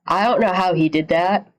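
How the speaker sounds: ordinary talk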